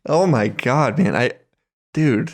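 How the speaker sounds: usual speaking voice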